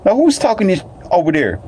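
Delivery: high startled girlfriend imitation; high mock girlfriend voice. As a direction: squeal it out, startled. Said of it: "she was like".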